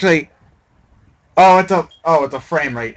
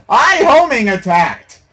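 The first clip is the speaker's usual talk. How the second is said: slower indignant shout; 6 semitones higher, 7 dB louder, slower than usual. Real, not acted. Gamer repeating the failed homing attack at the screen, slow outraged shout. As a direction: stretch it out, still loud and high.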